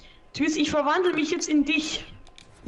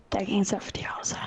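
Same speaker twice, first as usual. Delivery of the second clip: whisper; 7 semitones lower, 6 dB quieter than usual. Real, not acted. Unvoiced aside.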